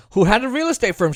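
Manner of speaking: normal talking voice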